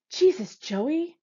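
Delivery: high, drawn-out yell of alarm; shouted reproach, slow and high; exasperated yell. A narrator's reading. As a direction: yell it in exasperation, pitched well up. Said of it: "Frosty yells at Stormy".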